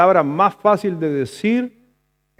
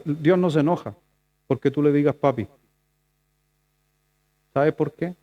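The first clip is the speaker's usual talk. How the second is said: ordinary talk, nothing marked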